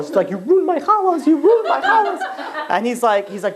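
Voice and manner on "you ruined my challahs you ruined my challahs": high-pitched hammed-up crying wail; mock hysterical. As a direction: imitate a hysterical accusation, drawn out at the end. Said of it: "She's, like, hysterical".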